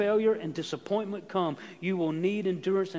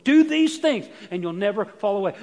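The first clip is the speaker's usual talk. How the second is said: shouted promise; 2 semitones higher, 10 dB louder than usual. Acted. Preacher shouting Peter's promise to the congregation. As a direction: belt it out to the back row. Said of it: "with the emphasis on this".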